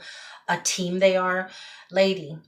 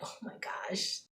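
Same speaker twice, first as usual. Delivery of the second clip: retold exasperated whisper; whispered dismay; 14 dB quieter, slower than usual. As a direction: whisper it under the breath in mock dread. Retelling her dismayed reaction to request.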